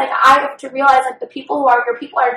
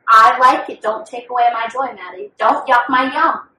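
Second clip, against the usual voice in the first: ordinary delivery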